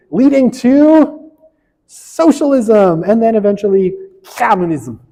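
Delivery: mock Russian accent, stretched and high; bad Russian accent. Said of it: "Can only say in a Russian accent, bad one".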